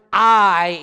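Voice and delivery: I said loud and held; a loud, weighty I; emphatic I. Shown as a demonstration. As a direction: punch out the word I. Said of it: "is emphatic in the Hebrew".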